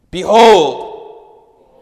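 loud booming behold; scream it out. Shown as a demonstration. Pastor leading the congregation in shouting behold, loud. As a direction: shout it at the top of your lungs. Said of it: "repeat after me".

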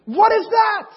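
high, loud disbelieving outburst; baffled surprise. Retold or imitated. Young man hearing his friend's church named, loudly baffled. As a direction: react like you've never heard of such a thing. Reacts to friend's unfamiliar answer.

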